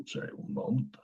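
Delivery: quick whisper off-mic; whispered aside. Real, not acted. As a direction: whisper it under your breath.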